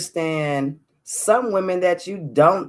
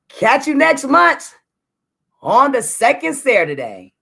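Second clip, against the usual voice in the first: excited sign-off; 10 semitones higher, 7 dB louder than usual. Real, not acted. Host signs off to her podcast audience, bright and much louder. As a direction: sing out the goodbye to your listeners.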